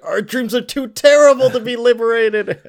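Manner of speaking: high, loud anguished refusal; dramatic protest. Retold or imitated. The face on his arm protests, high and loud. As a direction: cry out against freeing the dreams.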